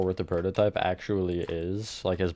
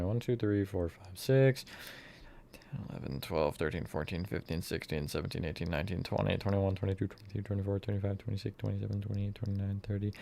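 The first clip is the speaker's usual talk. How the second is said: counting under breath; 9 dB quieter than usual. Real, not acted. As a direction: count quietly to yourself. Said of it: "Hold on, I'm counting".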